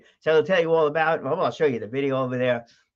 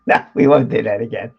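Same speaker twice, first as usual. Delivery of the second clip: loud, lower-pitched refusal; loud retraction; 4 semitones lower, 8 dB louder than usual. Real, not acted. Host taking back his call to replay, loud refusal. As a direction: drop the pitch and refuse loudly.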